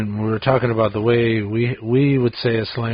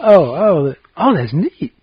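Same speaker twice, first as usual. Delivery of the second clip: louder, higher sincere reaction; sincere approval; 5 semitones higher, 5 dB louder than usual. Acted. Showing the friend the proper use, sincere approval. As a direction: say it as a proper compliment. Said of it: "you just used it properly".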